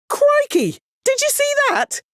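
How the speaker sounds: high astonished cry; amazed exclamation. Acted. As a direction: gasp it out in amazement. Amazed at player's feat.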